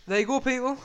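loud, high, hammy farewell; showy sign-off. Real, not acted. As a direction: sign off like a host.

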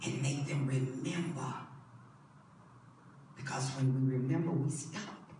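low, soft preaching; quiet, intimate sermon reflection; hushed sermon voice. Acted. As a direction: draw the church in close and quiet.

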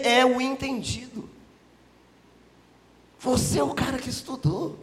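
high-pitched, measured challenge to an expert; pointed, pausing address to a scholar; Jesus. Acted. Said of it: "Jesus responde assim para ele".

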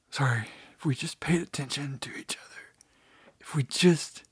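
soft, whispery mock-emotional voice; feigned choking up. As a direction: pretend to get choked up, voice nearly gone. Pretends an emotional breakthrough mid-speech.

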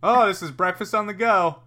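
high, loud mocking chirp; mock cheerful. Friends mocking bagel eaters, mock cheerful. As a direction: mimic the clueless crowd with big cheer.

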